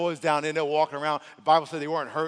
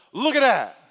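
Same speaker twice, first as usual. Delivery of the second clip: loud, high, punched taunt; taunting shout; 7 semitones higher, 11 dB louder than usual. Acted. Doubting voice in your head points at failure, taunting shout. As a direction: jeer it out loudly, falling at the end. Taunting inner voice in acted scene.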